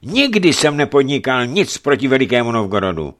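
loud, high-pitched self-defence; indignant boyar defending himself; vehement protest. A narrator's reading. Boyar protests against the accusation.

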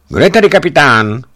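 high, loud delirious cry from the car; feverish call. A narrator's reading. Sick wife calling from the car to the men, loud and high. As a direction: call out sharply, confused by fever.

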